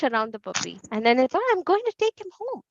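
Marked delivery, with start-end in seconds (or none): 1.5-2.6 put-on high, childlike Nimai voice; child's voice. A narrator's reading. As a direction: speak like an eager little boy.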